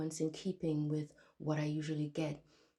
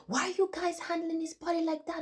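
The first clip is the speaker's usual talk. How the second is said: high, loud, frantic protest; retold scream; 12 semitones higher, 5 dB louder than usual. Retelling a dream, yelling at hospital staff, high frantic shout. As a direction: yell at them to stop. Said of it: "I started screaming to the hospital staff".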